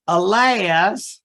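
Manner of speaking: ordinary talk, nothing marked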